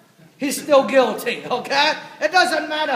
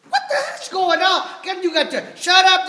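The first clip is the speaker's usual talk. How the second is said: raised, high-pitched outcry of an alarmed crowd; higher, half-shouted crowd protest; mock screaming crowd; 6 semitones higher, 4 dB louder than usual. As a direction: imitate people yelling over a commotion. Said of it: "people would be screaming".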